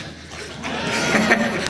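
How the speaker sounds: airy unvoiced laughter; real laughter at the prank; breathy laugh. Real, not acted.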